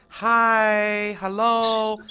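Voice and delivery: a pleasant but ordinary hi to the class; normal greeting. Shown as a demonstration. Trainer showing a greeting that won't work, normal hello. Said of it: "So the normal".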